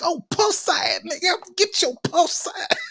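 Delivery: high, mad girlish voice imitating the daughter. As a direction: squeal it like an angry teen girl. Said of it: "his daughter sounds like the female version of [name]".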